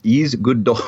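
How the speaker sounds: mock Russian accent for a gag; comic Russian accent. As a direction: imitate the Russian technician. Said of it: "Is she Russian?".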